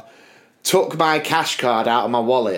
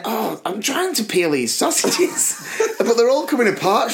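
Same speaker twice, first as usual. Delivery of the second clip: mock helpless whine; 7 semitones higher than usual. Acts own helpless complaint to Kim.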